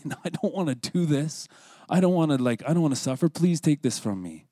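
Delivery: high, loud protest, begging off; hammed-up desperate refusal; acted pleading. Acting out Jesus's plea.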